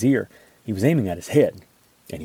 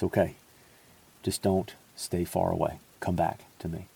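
much softer, lower, tender; gentle reassurance; 4 semitones lower, 8 dB quieter than usual. Pretend. Jesus comforting Peter after the denial, soft and tender. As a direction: soothe him like a forgiving friend. Jesus imagined calling us back.

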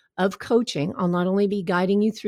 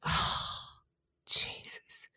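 whispered sigh of dismay; hushed, voiceless disbelief; deflated; 15 dB quieter, much slower than usual. Acted. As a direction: breathe it out in defeat, barely audible. Voices deflated viewer's reaction.

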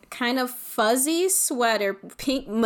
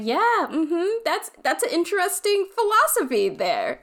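humoring mom; 9 semitones higher than usual. Retold or imitated. Mom humoring child's pants philosophy.

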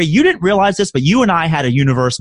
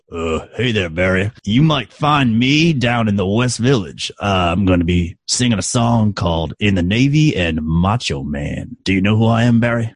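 [name] biker impression; 7 semitones lower than usual. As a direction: play it like a tough biker. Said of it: "impersonation of a famous person with a mustache".

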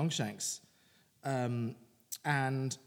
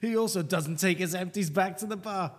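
hammed-up Scottish accent; mock Scottish accent; 5 dB louder than usual. Co-host reading a listener's hate poem, mock Scottish accent. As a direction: read it out in a broad joke Scottish accent. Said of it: "offensive Scottish accent now".

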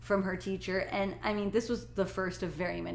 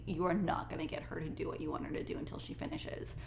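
emphatic whisper; 4 semitones lower, 8 dB quieter than usual. Real, not acted. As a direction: hush it for emphasis. Emphatic aside.

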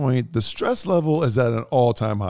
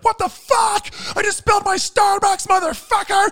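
loud, very high mock shriek; hammed-up screech of outrage; sister's outburst; 4 dB louder than usual. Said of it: "and she's like".

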